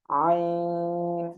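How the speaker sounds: আইন pressed from mid-throat, "i" held long and level. Shown as a demonstration. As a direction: intone আইন very slowly. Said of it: "গলাটা চেপে হালকা উচ্চারণ".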